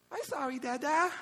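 put-on high little-kid apology; imitating his toddler. Scolded toddler apologizing to dad, high imitated child voice.

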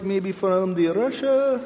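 a mock Russian accent, slow and soft; a playful fake Russian accent. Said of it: "I can even talk".

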